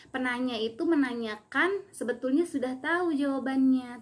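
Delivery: ordinary talk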